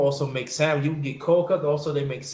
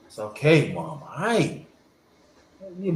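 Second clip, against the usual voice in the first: ordinary talk, nothing marked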